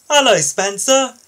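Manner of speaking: high, loud, bright hello; an excited crane greeting his friend; cheerful greeting. Acted. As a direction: beam at the visiting engine. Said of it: "smiled Kevin".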